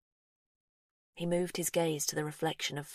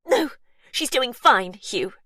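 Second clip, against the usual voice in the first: higher, louder insistent woman; Verity, defensive; 7 semitones higher, 9 dB louder, slower than usual. A narrator's reading. Verity refusing Hugh's offer of help, insistent. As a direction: brush off his worry, sharply.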